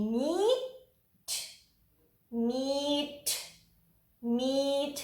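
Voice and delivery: मीट drawn out very slowly, the ee held long; stretched blending. Shown as a demonstration. Teacher blends the sounds for the children, very slow. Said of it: "इसके अंदर sounds देखें".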